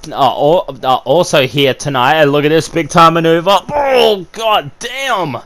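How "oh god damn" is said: high, slow God damn in shock; drawn-out stunned exclamation. Real, not acted. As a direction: let it stretch out in disbelief. Reacts to big maneuver.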